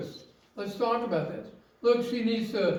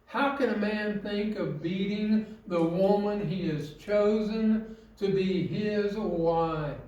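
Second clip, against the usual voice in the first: usual speaking voice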